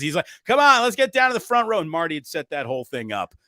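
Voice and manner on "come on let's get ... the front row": high, loud coaxing call; eager insisting. Retold or imitated. Marty insists they move down front, eager insisting. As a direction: urge them along, voice up high. Retelling friend insisting on moving.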